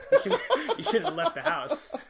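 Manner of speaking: loud, high laughing taunt. Real, not acted. Mocking a kid opponent, laughing.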